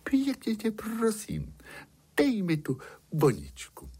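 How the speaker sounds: high, honeyed princess plea; sugar-sweet begging; sweetest voice. A narrator's reading. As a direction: coax as sweetly as you possibly can, pitched high. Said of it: "Princezna řekla tím nejsladším hlasem".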